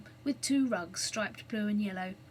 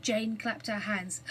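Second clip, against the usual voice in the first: ordinary delivery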